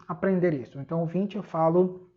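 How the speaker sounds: usual speaking voice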